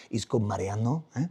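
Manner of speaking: put-on low, sultry Spanish-accented voice; sexy Spanish accent. Comedian showing off his father's sexy Spanish accent. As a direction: play the suave Spanish father with a thick accent. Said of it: "He has a very sexy Spanish voice".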